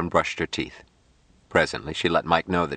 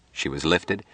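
ordinary delivery, nothing marked

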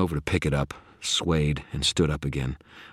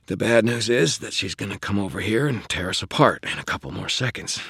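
higher, strained delivery; strained bad news; 5 semitones higher than usual. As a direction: break the bad news under pressure. Wizard breaks bad news under threat.

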